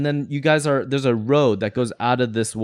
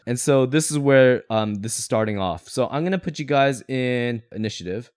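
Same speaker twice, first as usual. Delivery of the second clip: ordinary talk, nothing marked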